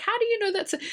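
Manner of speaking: high, mocking disbelief; a jeering, sky-high how-do-you-know; incredulous. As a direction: pitch it up in scornful disbelief. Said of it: "And I'm like".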